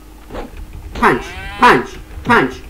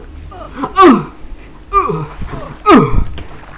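loud sharp gasp; big dramatic gasp; 11 semitones higher, 6 dB louder than usual. Retold or imitated. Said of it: "that's the sound it makes".

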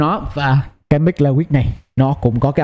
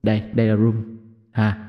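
room reverb; 5 semitones lower than usual. Shown as a demonstration. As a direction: voice with small-room echo. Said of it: "chúng ta sẽ có cái room".

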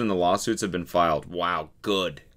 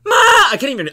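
very high, loud squeak; straining for the note; 13 dB louder, faster than usual. Shown as a demonstration. Trying to hit the woman's note, very high loud squeak. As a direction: reach way up and crack. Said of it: "I can't even access that note".

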